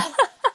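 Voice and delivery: high, quick laugh; a quick laugh at herself; short laugh. Real, not acted. Laughing at her story.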